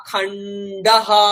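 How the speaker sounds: খান্ডাহা stretched slowly, visarga sounded as a full ha; slow Sanskrit word. Shown as a demonstration. Teacher sounding the visarga for students, slow and drawn out. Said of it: "তাহলে সুধা".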